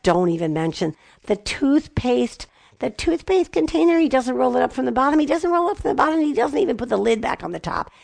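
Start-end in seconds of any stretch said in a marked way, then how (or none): 1.3-7.9 high-pitched, fussy spousal griping; nagging complaint. Acted. Wife griping about husband and the toothpaste, nagging complaint. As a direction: rattle off a petty grievance in a higher voice.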